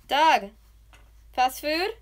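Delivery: loud, high call rising to a question. Real, not acted. Luring sleepy boyfriend with food, calling out. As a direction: call out a tempting offer.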